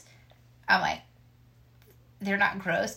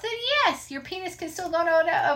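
excited; 8 semitones higher than usual. Real, not acted. Excited argument about arousal.

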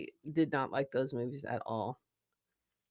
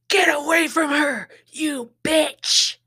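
high, loud action-heroine shout; Sigourney Weaver; 10 semitones higher, 9 dB louder, slower than usual. Acted. Acting out the film line as a hint, shouted. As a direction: yell it as a fierce command. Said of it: "Did I just do Sigourney Weaver so well".

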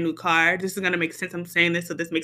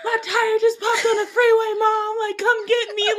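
high, tearful, half-laughing plea to mom; crying on the phone to mom about a popped tire; retold crying call; 13 semitones higher than usual. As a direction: sob it out between laughs, pitched way up. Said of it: "so I'm crying".

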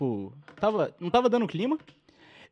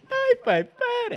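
very high pleading squeal; much slower than usual. Real, not acted. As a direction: squeal it in disgust. High cry of disgust.

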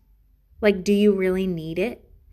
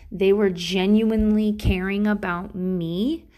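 ordinary talk, nothing marked

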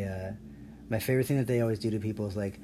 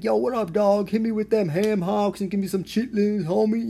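much higher, louder hammed-up fake slang; fake soul-food slang; 10 semitones higher, 6 dB louder than usual. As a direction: overdo the wannabe homie greeting. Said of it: "are you acting like that?".

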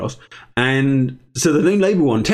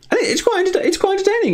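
high pitched giggly delivery; laughing; faster than usual. Real, not acted. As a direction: giggle through it. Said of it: "laughing high voice".

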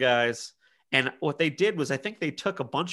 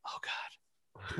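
whispered morning-after groan; retold hushed, hungover horror; dread-filled whisper; 18 dB quieter than usual. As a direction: whisper it as if just waking to disaster. Waking hungover, dreading what happened.

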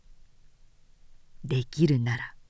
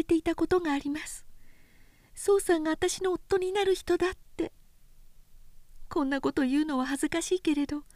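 high, quick, soft heartfelt plea; pleading young woman; 9 semitones higher, 4 dB quieter, faster than usual. A narrator's reading. Oyuki begging Sōshichi to let her stay, high and pleading. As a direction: pour out her heart to him.

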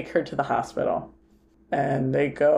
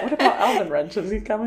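laughing mock grump; 5 semitones higher, faster than usual. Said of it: "It's just like".

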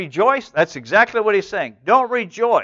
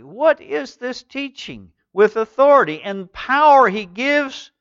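amazed crowd; 9 semitones higher, 4 dB louder than usual. Acted. Amazed crowd voiced in reading.

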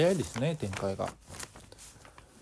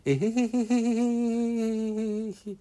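high, eerie drawn-out giggle; creepy ghost giggle; 10 semitones higher, 5 dB louder, much slower than usual. Acted. Possessed Kuriko giggles at the class, high and eerie.

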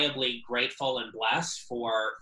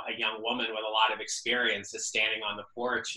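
ordinary talk, nothing marked

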